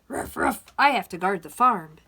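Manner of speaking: loud refusal with gruff little barks; storybook dog. A narrator's reading. Dog turning down the hen, loud and doggy. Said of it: "said the dog".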